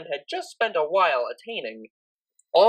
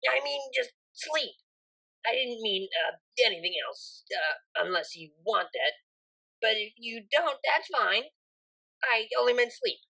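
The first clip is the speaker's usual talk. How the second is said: softer, higher, flustered backpedalling; flustered mare; 5 semitones higher, 6 dB quieter than usual. A narrator's reading. Vinyl backpedalling to blushing Octavia, softer and flustered. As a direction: rush to explain yourself, embarrassed.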